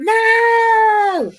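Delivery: long, very high wailing protest; retold scream. Mother retelling hearing the news from son, screamed protest. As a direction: act out screaming at bad news. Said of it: "and I just screamed".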